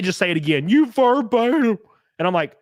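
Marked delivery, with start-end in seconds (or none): 0.7-1.8 high, slow, dopey accuser; mock critic, high and louder. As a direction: drag out a dumb "You verbatim". Mocking critics.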